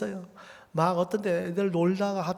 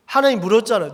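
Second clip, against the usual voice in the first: normal talking voice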